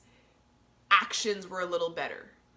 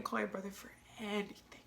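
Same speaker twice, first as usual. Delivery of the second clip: soft unvoiced whisper; 9 dB quieter than usual. Real, not acted. Whispering to camera about her brother, tearful. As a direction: whisper it through tears.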